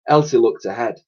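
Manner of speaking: plain reading voice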